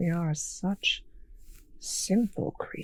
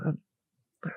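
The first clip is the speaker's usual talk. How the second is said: usual speaking voice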